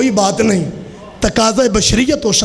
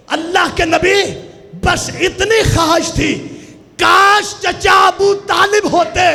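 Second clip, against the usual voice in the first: full-force preaching; 7 semitones higher than usual. Acted. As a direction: wail it out to the mourners. Majlis climax, shouted recitation. (ऊँची आवाज़ में जोशीला मजलिसी बयान)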